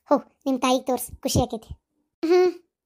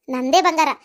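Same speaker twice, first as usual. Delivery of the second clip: ordinary talk, nothing marked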